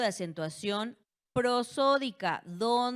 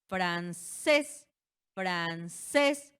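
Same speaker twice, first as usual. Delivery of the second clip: "Francés" with a louder, raised "cés"; stress on last syllable; 5 dB louder, much slower than usual. Shown as a demonstration. Teacher demonstrating an acute word to class, final stress on "Francés". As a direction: split it slightly and punch "cés" in "Francés". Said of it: "Recae la sílaba tónica en la última".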